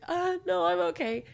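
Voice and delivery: mock a tearful brave face; teary reply. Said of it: "And I was like".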